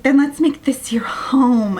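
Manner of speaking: mock tender and whispery; mock romantic. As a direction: draw it out in a hammed-up lover's whisper. Queen voiced romancing her champion.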